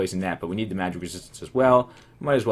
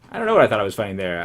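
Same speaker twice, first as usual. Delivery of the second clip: usual speaking voice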